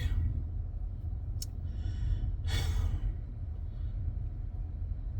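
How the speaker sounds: long unvoiced weary sigh. Real, not acted. Fan giving up on his team, heavy sigh. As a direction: let out a long tired breath. Dejected fan after ranting.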